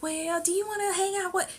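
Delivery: high-pitched imitation of a woman; retold coy invitation. Woman invites visitor to hang out, high mock voice. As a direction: put on a high voice for her invite.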